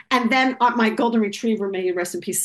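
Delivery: plain reading voice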